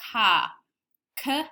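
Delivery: car then K-R split into its sounds; sounded out. Shown as a demonstration. Teacher demonstrating segmenting for children, sound by sound. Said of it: "Listen as I sound out the word".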